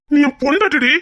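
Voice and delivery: husband telling his wife she is his everything; emotional declaration. Acted. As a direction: pour your heart into it.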